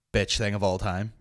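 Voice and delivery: ordinary talk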